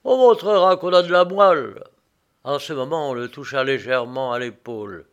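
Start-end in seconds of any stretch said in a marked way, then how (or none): 0.0-1.8 loud, defiant swagger, higher; bravado. A narrator's reading. Doomed prisoner showing off his nerve, loud bravado. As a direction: put on a brave face, boasting. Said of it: "il crâna".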